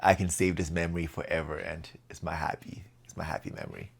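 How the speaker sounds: dreamy quiet reverie. Retold or imitated. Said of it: "that you're like".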